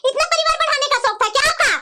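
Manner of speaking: ordinary talk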